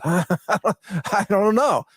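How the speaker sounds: slow, drawn-out laughing shrug; chuckling I don't know. Real, not acted. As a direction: laugh it off, stretching each word. Laughs at the long title.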